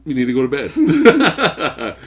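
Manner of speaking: slow, laughing echo; teasing laugh. Real, not acted. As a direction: tease him with a laugh.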